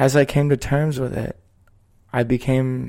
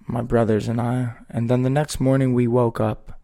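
ordinary delivery

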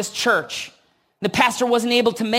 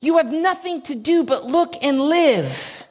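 preacher calling out; 6 semitones higher than usual. Retold or imitated. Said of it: "He says".

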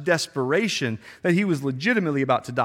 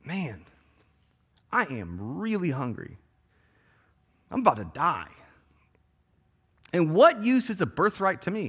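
weary hungry Esau; 2 semitones higher than usual. Retold or imitated. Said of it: "he says".

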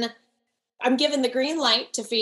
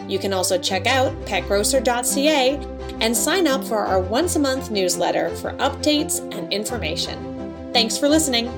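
ad read; 6 dB louder than usual. Acted. Podcast outro read over music.

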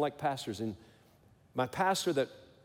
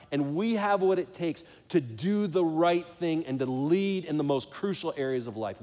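rally the men from the pulpit; fervent preaching; 4 semitones higher, 4 dB louder than usual. Acted. Sermon climax, preaching.

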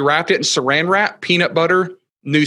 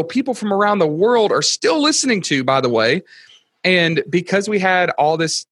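ordinary talk

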